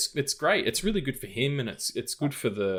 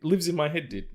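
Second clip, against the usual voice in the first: laughing, pitched up; 12 semitones higher, 7 dB louder than usual. Real, not acted. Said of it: "What the fuck are prunes?".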